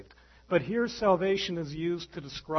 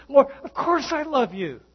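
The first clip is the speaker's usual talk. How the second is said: higher, louder protest of love; earnest Peter; 10 semitones higher, 9 dB louder than usual. Retold or imitated. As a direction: insist with eager sincerity. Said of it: "Peter says".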